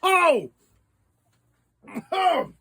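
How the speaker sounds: high startled oh at the sour hit; sour shock. Real, not acted. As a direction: gasp at the sourness. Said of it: "I'm going to need some water".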